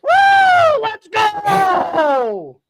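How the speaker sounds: high, loud celebratory yell; victory whoop. Real, not acted. Host celebrating the Broncos win with a whoop. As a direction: whoop it out like your team just won.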